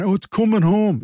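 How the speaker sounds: mock an English football fan chanting, in an English accent; hammed-up English accent. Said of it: "That was my English accent".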